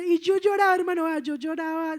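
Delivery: high, emotional recollection; tearful retelling. Retold or imitated. Relives weeping, voice high.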